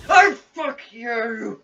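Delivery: startled scream. Real, not acted. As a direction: jump out of your skin and shriek. Jumpscare startles the player.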